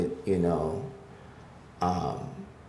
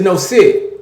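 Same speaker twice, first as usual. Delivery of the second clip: sharp, much louder and higher command; dog command; 11 semitones higher, 12 dB louder than usual. Retold or imitated. Owner ordering his dog to sit, loud and high. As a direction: order the dog to stay put.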